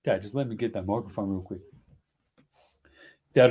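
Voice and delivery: ordinary delivery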